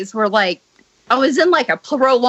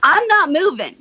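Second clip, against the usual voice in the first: retold firm refusal; 5 semitones higher than usual. Acting out her firm refusal.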